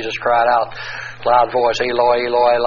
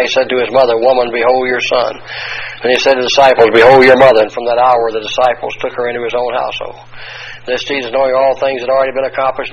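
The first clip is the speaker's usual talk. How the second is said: forceful, louder Bible reading from the pulpit; loud scripture reading; 6 dB louder than usual. Acted. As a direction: proclaim the Gospel passage to the congregation.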